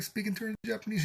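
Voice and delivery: ordinary talk, nothing marked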